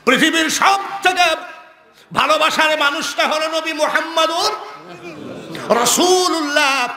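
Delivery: high ringing proclamation; forceful preaching. Acted. Preacher proclaiming the most beloved one, high forceful preaching. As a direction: proclaim each phrase, pausing between them.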